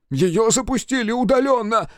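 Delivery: high-pitched urgent report; alarmed call. Acted. Engineer tells crew the bomb was triggered, alarmed call.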